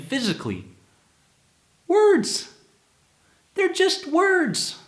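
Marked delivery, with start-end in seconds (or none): none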